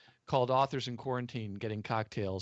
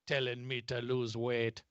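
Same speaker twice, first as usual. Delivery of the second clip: Fat Bastard. Acted. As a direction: do a comic movie Scotsman. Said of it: "That's my best Scottish accent".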